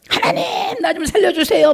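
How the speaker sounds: lightly hammed-up desperate prayer; mock pleading drowning man. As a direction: play the drowning man begging God, half laughing. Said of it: "막 이랬어요".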